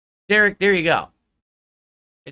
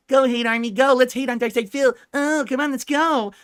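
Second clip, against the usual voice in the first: high, sing-song cheer of a silly troop; rally the little army with mock zeal; mock rally cry; 7 semitones higher than usual. Mocking detractors cheering on their hate army, high mock cheer. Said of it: "They put on their little army hats".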